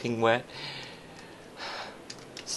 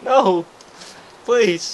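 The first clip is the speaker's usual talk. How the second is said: pleading; 10 semitones higher, 9 dB louder than usual. Acted. Begging not to be kicked out.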